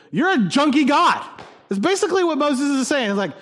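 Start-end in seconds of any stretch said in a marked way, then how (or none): 0.1-1.2 raised, loud mock complaint; mock whining. Preacher paraphrasing Moses' prayer to God, mock whining. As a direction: whine it loudly, higher up. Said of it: "He is whining. He said".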